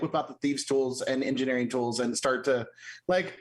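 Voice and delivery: normal talking voice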